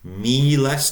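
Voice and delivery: Miles with a long first E. Shown as a demonstration. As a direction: stretch the E in Miles. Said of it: "Notice the long E".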